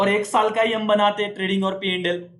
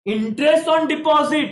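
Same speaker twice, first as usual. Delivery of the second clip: raised, drawn-out quiz prompt; drilling call-out; 7 semitones higher, 4 dB louder, much slower than usual. Shown as a demonstration. Teacher drilling the class on interest entries, calling out. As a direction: call it out slowly, high and loud. Calls out next quiz item.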